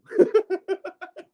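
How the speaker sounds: long high chuckle; laughing. Real, not acted. Laughs at hilarious contradiction.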